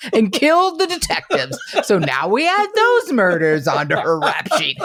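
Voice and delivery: high-pitched start, laughing through it; laughing comic riff. Pretend. Detectives adding murders to her rap sheet, laughing mock accusation. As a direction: pile on the absurd charges, cracking up. Riffing on polygraph disaster, laughing.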